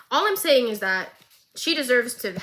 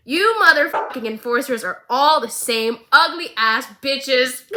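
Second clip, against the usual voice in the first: mock insult; 2 semitones higher, 7 dB louder than usual. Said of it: "I'm kidding, she does not say that".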